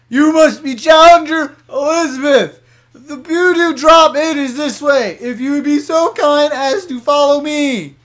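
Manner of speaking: high, loud, slow put-on voice; shrieking attendant. Voicing the gym attendant for a friend, high loud slow. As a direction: announce it slowly in a high shriek.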